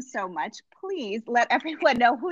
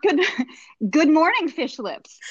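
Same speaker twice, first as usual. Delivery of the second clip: bright good morning, louder and higher, then dropping for fish lips; mock cheery greeting; 5 semitones higher, 4 dB louder, slower than usual. Host reading the guest's silly greeting, mock cheery. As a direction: greet them like a chirpy coach. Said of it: "an actual Sarah Duff greeting".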